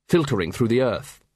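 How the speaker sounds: plain reading voice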